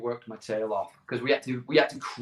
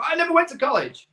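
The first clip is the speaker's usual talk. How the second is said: excited blurt; 6 dB louder, faster than usual. Retold or imitated. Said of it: "And I was like".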